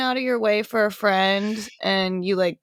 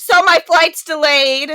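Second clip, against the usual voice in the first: loud, slow, higher moan; friend's weary complaint; 7 semitones higher, 9 dB louder than usual. Retold or imitated. Friend calls to complain, loud slow moan. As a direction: drag out the bad news. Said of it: "And he was like".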